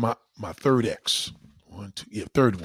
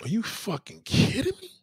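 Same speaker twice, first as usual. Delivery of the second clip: high incredulous mock reaction; high, disbelieving "are you kidding me"; disbelief; 10 semitones higher than usual. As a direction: act stunned at the photo. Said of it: "you be like".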